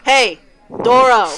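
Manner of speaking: loud holler to get someone's attention; calling out. Acted. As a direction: shout a name across a bar. Giant calls across the bar.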